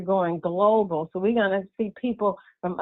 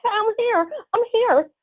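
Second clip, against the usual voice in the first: excited calling; 13 semitones higher than usual. Retold or imitated. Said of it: "'cause I'm like".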